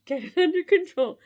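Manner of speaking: losing it while saying it; laughing. Real, not acted. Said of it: "I can't do it".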